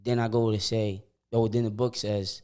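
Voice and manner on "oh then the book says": ordinary delivery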